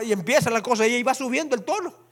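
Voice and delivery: ordinary talk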